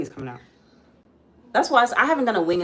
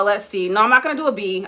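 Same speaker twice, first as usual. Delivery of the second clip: normal talking voice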